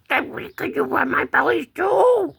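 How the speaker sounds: high put-on kitty request; cat voice. Second cat asking for a belly rub, high cat voice. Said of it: "And she says".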